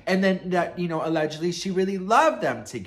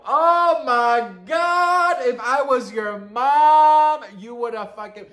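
loud, high and slow, spelled out; outraged; 7 semitones higher, 8 dB louder, slower than usual. Retold or imitated. Vlogger scolding a rude teenage cashier, loud and outraged. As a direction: lay down the law like a mom.

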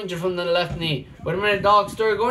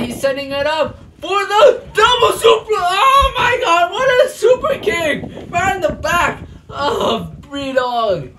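much higher, louder cracking scream; excited yell; 9 semitones higher, 6 dB louder, slower than usual. Acted. Commentator reacting to a double super kick, screaming. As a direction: lose it over the super kick.

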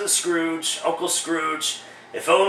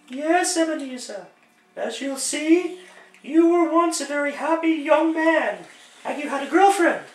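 much higher, airy woman's voice for the ghost; falsetto female ghost; 9 semitones higher than usual. Acted. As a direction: play the spirit as a tall lady. Ghost narrates the past.

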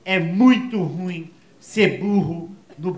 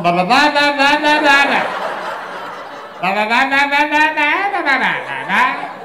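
higher, louder blah blah babble; higher, louder nonsense blah; mock gibberish; 6 semitones higher, 4 dB louder, much slower than usual. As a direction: babble like the man heard it. Gibberish he seemed to speak.

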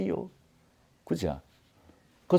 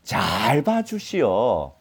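slow, ingratiating plea; drawn-out buttering-up; flattering plea; much slower than usual. Acted. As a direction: stretch 잘 out long, begging a favour. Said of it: "미리 아부하는 게".

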